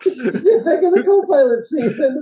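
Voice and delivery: high, loud, through laughter; laughing mock order. Co-host ordering the stewardess, laughing. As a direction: bark it while cracking up.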